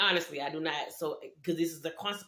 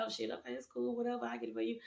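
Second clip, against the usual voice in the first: soft, higher, timid going-along; meek mumbled reply; 5 semitones higher, 9 dB quieter, faster than usual. Retold or imitated. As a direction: give in quietly to avoid trouble. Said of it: "I was like".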